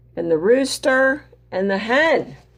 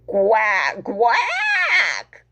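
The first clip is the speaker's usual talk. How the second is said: shouted duck quack; squawking duck. Acted. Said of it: "squawked Duck".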